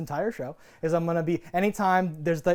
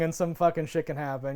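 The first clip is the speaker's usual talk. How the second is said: ordinary talk, nothing marked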